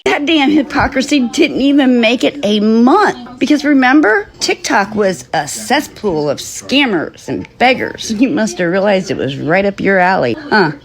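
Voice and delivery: loud, furious rant; shouting, fed up and scornful; angry. Real, not acted. Host rants at streamer.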